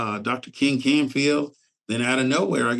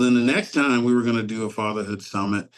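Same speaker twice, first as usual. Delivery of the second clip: normal talking voice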